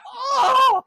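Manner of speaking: a loud laughing "Oh!"; laughing exclamation. Real, not acted. Telling the fart story, a loud high laughing "Oh!".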